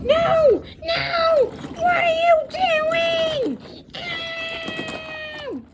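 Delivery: high-pitched frantic yell; panicked yelling. Acted. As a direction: shriek in alarm. Train character panicking.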